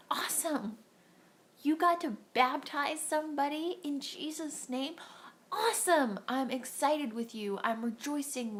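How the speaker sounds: high, loud cheering awesome; excited cheer. Acted. Preacher cheers on a friend who baptized someone. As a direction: celebrate their win with you.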